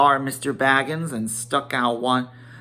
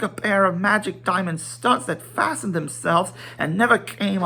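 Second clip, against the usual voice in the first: high, tumbling, delighted recollection; excited Bilbo; 7 semitones higher than usual. Acted. Bilbo recalling Gandalf's fireworks, high and excited. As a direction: gush over the old fireworks.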